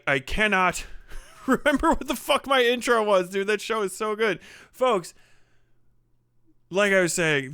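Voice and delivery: ordinary talk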